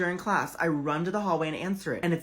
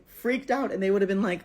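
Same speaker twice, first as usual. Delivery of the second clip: ordinary talk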